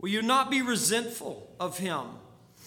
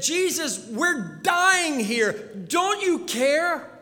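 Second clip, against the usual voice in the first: slow, pleading cry for help; desperate cry; 4 semitones higher, 3 dB louder, much slower than usual. Acted. Frightened disciples pleading with sleeping Jesus, desperate. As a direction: beg Jesus to wake and save them.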